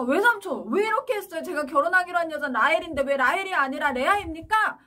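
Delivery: high, loud angry outburst; angry protest. Acted. Jacob confronting his uncle after the wedding trick, loud and angry. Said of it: "이렇게 화를 냈더니".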